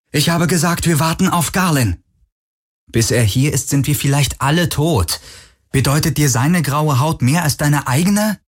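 put-on a frightened girl holding a gun, defiant; tense young woman. A narrator's reading. Narrator voices Kirai higher.

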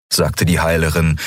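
plain reading voice

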